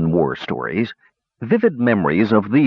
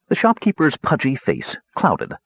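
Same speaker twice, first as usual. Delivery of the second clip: ordinary delivery, nothing marked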